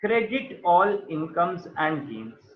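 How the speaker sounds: spelled out slowly, each word apart; slow dictated rule. Shown as a demonstration. Teacher dictating the accounting rule to students, slow. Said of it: "nominal accounts के लिए जो rule चलता है".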